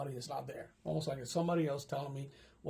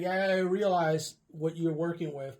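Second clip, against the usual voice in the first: ordinary delivery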